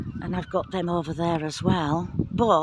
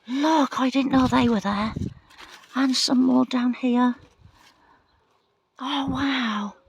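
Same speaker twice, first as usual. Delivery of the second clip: higher and louder, thrilled to find them; excited discovery; 6 semitones higher, 4 dB louder than usual. Real, not acted. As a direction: point them out with real delight. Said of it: "That was exciting for me".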